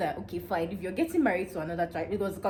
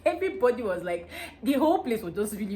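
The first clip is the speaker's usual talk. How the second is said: ordinary talk, nothing marked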